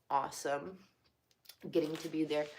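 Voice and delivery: ordinary delivery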